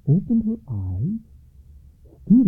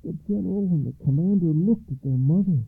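usual speaking voice

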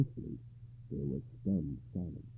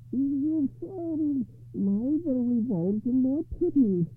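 slow lady voice; 6 dB louder, slower than usual. Acted. Enchanted lady to husband.

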